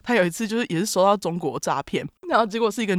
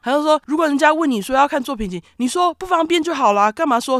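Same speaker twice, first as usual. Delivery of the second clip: higher, louder indignant scolding; angry scolding; 8 semitones higher, 4 dB louder than usual. Retold or imitated. Scammer scolding her for being rude, indignant and raised. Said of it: "结果他就更生气".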